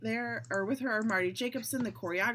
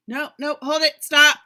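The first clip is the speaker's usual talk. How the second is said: raised voice building to a loud shout; calling a halt; 6 semitones higher, 14 dB louder than usual. Acted. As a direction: cut the rehearsal off, louder and higher. Choreographer halts the dancers.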